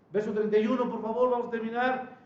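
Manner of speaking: normal talking voice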